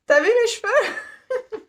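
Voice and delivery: hammed-up hair boast to Disney staff; giggly mock brag. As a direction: squeal it through a giggle, showing off. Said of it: "je vais dire".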